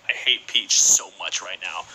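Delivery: low, slow seething mutter; grudging grumble. Acted. Beaten player cursing the winner, low slow mock seething.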